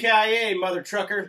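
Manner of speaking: catchphrase yell. Retold or imitated. Co-host shouts the famous Die Hard line, loud and high. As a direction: belt the catchphrase.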